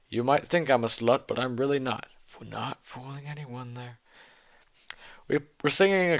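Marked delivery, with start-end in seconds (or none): 2.3-4.0 quiet aside. Real, not acted. Aside mocking the text.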